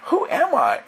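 high-pitched astonished question; amazed self-questioning. Retold or imitated. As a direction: wonder at your own strength in a high voice. Amazed at own sudden strength.

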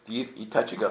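normal talking voice

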